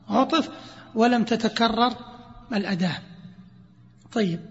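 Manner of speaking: ordinary delivery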